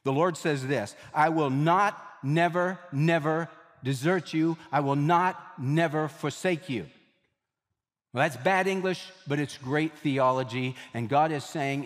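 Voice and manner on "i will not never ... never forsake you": "not"과 "never"를 하나하나 힘주어; stress on negatives. Shown as a demonstration. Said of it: "five negatives".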